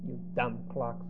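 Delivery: muttered aside. Retold or imitated. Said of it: "Joseph is saying".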